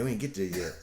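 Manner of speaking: ordinary talk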